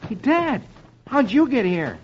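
put-on high, surprised little-boy voice; Tommy. A narrator's reading. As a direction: pipe up as a puzzled kid. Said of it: "And Tommy ... is saying".

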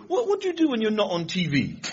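put-on high-pitched youth slang accent; young street slang accent. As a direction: imitate a curious lad's street accent. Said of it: "They all talk like that".

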